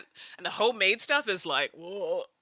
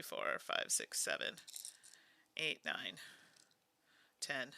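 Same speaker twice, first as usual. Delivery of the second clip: counting under her breath, unvoiced; whispered counting; 6 semitones lower, 12 dB quieter, slower than usual. Real, not acted. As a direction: whisper the numbers to yourself.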